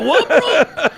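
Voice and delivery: put-on a baffled teen gangster laughing at him; gang member voice.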